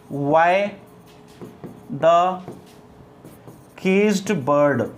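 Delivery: normal talking voice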